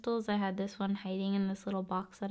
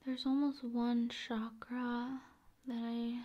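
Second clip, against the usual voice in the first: ASMR whisper; 6 dB quieter than usual. Acted. ASMR host pretending to examine viewer's brain, whispering. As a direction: whisper like examining them closely.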